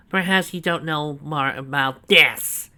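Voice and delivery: loud, high, slow villain crowing; gloating exclamation. A narrator's reading. Snively holding up the remote to Donovan, loud and gloating. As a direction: crow over the remote slowly. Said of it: "he exclaimed".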